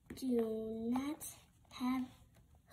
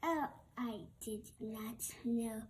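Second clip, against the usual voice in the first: usual speaking voice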